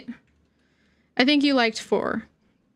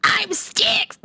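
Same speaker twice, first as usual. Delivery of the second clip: whispered character line; 8 semitones higher than usual. Pretend.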